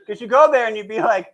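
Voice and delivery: ordinary talk, nothing marked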